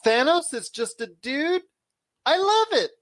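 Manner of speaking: high-pitched imitation of an excited kid; daughter's excited scream. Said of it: "And she screams out".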